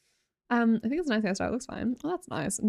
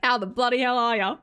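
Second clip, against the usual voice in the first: hammed-up, hearty Aussie accent; Australian accent; 3 semitones higher, 4 dB louder than usual. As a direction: ham up an Australian greeting. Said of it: "spawned in with this accent".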